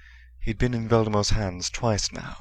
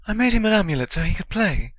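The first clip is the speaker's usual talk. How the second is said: fast, higher, louder hurried confession; quickly; 12 semitones higher, 6 dB louder, faster than usual. A narrator's reading. Hermione hastily explains to McGonagall, fast and high. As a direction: rush it out before anyone interrupts. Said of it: "Hermione said quickly".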